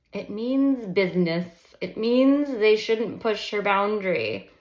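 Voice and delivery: loud firm narration; firm emphasis. A narrator's reading. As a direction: lean on every word.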